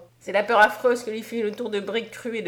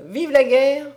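loud, high-pitched rallying cry; cri de foule; 4 semitones higher, 7 dB louder, slower than usual. A narrator's reading. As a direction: cry it out like a cheering crowd. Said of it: "Les cris de". (cri guerrier lancé fort)

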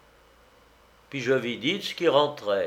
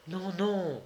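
soft, higher-pitched distressed protest; cri de détresse; 7 semitones higher, 6 dB quieter than usual. A narrator's reading. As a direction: protest in a small, high voice. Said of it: "s'écria la jeune fille". (protestation étouffée, plus aiguë)

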